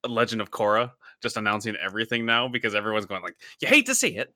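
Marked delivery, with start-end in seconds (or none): 3.6-4.3 high, bright 1930s announcer voice; old-timey announcer. Retold or imitated. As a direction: boom it out like a radio announcer. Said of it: "I just picture the announcer from, uh, Legend of Korra".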